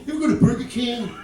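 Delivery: fast mock observational comic; Dane Cook impression. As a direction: rattle it off like a hyped stand-up. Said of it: "like Dane Cook".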